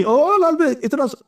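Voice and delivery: high mock shriek of fright; wife's shriek at cockroach. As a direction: squeal like a scared wife seeing a cockroach. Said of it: "چیخی". (لال بیگ دیکھ کر بیوی کی نقلی چیخ)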